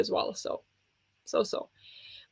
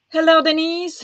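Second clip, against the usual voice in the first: bright greeting; 8 semitones higher, 5 dB louder than usual. Real, not acted. Greeting a chat viewer.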